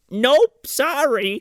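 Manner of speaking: high mock throwing-up-of-hands; retold high-pitched brush-off; dismissive refusal. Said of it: "throws her arms up and goes".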